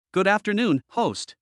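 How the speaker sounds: put-on high, cute baby voice. A narrator's reading. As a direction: chirp it like a tiny chick. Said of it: "spoke to Chen Nan in a baby voice".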